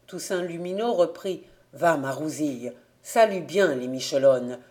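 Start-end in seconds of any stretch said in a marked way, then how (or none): 1.7-4.6 put-on lightly lowered voice of an old farmer giving orders; a hint of a stern father sending his girl off. Father instructing Rousille to go fetch Jean-Némi, lightly low and firm.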